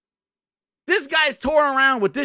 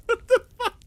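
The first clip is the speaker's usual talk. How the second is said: high, cracking disbelief; shocked laugh; 12 semitones higher than usual. Real, not acted. Stunned by the Hi-Fi Rush reveal, high and cracking. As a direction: squeak it out in shock.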